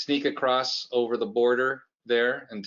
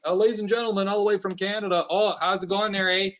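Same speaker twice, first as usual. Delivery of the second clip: mock Canadian host voice, higher; Canadian accent; 7 semitones higher than usual. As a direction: ham up the Canadian "eh" like an emcee. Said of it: "they just right away".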